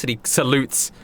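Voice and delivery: ordinary talk, nothing marked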